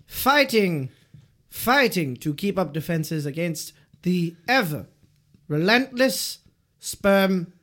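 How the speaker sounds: slow, weighty narrator building drama; mock dramatic narration. Host narrates the egg battle, mock dramatic.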